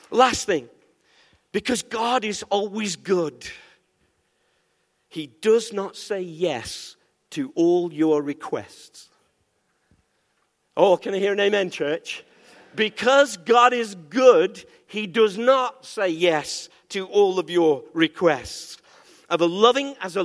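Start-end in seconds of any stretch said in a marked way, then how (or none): 1.5-9.1 slow, unhurried teaching line; measured statement. Acted. Pastor first states the point slowly to the church. 12.7-18.8 louder, slow preaching on God; emphatic proclamation. Acted. Pastor repeats the point forcefully after asking for amen.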